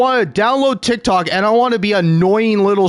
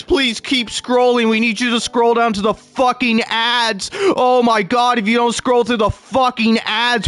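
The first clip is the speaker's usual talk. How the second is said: higher, whiny begging app voice building to a shout; pleading TikTok voice; 6 semitones higher than usual. Retold or imitated. Streamer imitating TikTok begging him to scroll to the ads, higher whiny plea. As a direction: beg the user to keep scrolling. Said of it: "And it'll be like".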